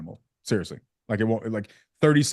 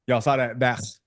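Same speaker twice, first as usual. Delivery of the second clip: usual speaking voice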